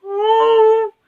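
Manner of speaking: very high startled granny cry; frightened cry. A narrator's reading. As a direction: shriek in fright. Grandma sees lion wants her.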